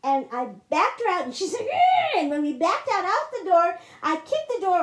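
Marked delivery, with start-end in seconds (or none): 1.6-2.2 short high, loud screech; sudden piercing mock shriek; retold angry shriek. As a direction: mimic her enraged scream. Mother lunging, retold scream.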